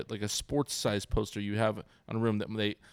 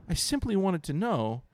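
higher, slow faux-earnest explanation; mock innocent son; 7 semitones higher, slower than usual. Son explaining the pun to dad, lightly voiced mock innocence. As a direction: play it prim and innocent. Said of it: "He goes".

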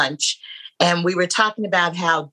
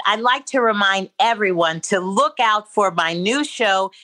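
ordinary delivery

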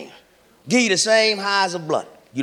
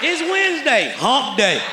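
put-on drunk's weekday excuses; 5 semitones higher than usual.

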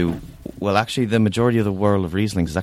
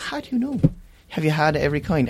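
incredulous; 7 semitones higher, faster than usual. Retold or imitated. Quoting what she'd tell customers.

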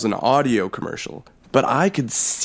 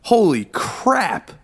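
awed exclamation; 6 semitones higher, slower than usual. Retold or imitated. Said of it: "I am just like".

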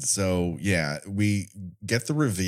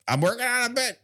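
much higher, mock-defensive protest; mock-defensive insistence; faster than usual. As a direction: insist in a high put-on voice. Mock-defensive reply to Becky, repeated.

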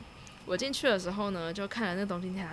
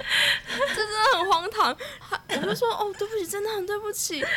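giggling at how absurd her apology was; laughing; 12 semitones higher, 4 dB louder than usual. Real, not acted. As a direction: laugh through the sorry.

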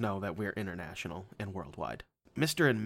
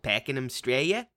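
slow Aussie; 2 semitones higher, slower than usual. Retold or imitated. Repeats in Aussie accent.

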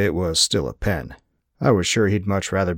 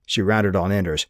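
ordinary delivery, nothing marked

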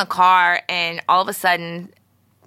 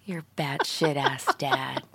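whispering; 4 semitones lower, 9 dB quieter than usual. Pretend. Continues mock whisper.

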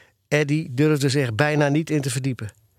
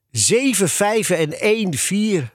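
slow, drawn-out report of bad marks; mildly dejected, higher and slow; sighing; 5 semitones higher, slower than usual. A narrator's reading. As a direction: sigh out the dismal tally. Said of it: "zuchtte Kees".

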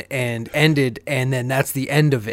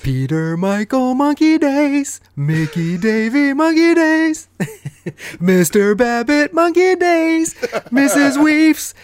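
high, slow hammed-up showbiz announcer; 12 semitones higher, slower than usual. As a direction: boom it out like a game show host. Mock announcer intro.

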